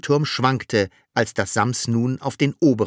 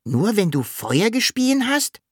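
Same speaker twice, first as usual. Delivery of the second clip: high, rising check; questioning Sams; 11 semitones higher than usual. A narrator's reading. Sams checking what the dragon meant, high questioning voice. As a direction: ask back curiously, pitched way up. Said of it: "fragte das Sams".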